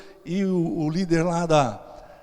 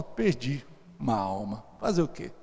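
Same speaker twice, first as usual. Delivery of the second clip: lower, softer, mock defeated; mock lament; 7 semitones lower, 7 dB quieter than usual. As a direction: sigh it out as a lost cause. Pastor lamenta perder o médico. (lamento de brincadeira, grave e baixo)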